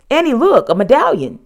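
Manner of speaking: loud, high excited call; eager, insistent holler. A narrator's reading. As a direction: shout to get her attention. Jack calls to distant Annie.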